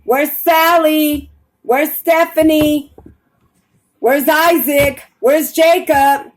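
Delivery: high, drawn-out searching calls; calling out. Acted. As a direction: call down for the missing, name by name. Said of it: "I'm screaming, saying".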